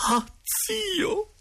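very high, wailing falsetto; high-pitched plaintive exclamation; exclaiming directress. A narrator's reading. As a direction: cry out to the dead uncle in falsetto. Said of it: "esclamò la signora Geltrude".

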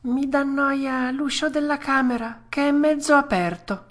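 high-pitched fussy puppet; whiny excuse. Acted. Puppet blaming the open door, high whine. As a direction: find fault in a high child's voice. Pinocchio makes another excuse.

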